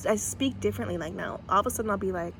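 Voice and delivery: usual speaking voice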